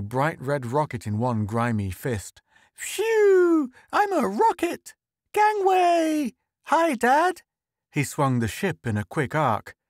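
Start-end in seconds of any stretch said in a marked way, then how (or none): 2.7-7.4 put-on loud, very high child's voice; excited little boy. A narrator's reading. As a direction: burst in shouting, playing at being a rocket. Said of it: "His son ran in".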